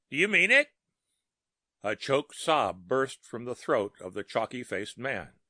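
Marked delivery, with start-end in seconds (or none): 0.1-0.7 high, loud disbelieving ask; startled question. A narrator's reading. Startled bellhop asks.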